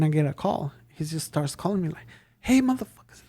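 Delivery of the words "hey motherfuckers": angry caller. Retold or imitated. Angry customer on the phone.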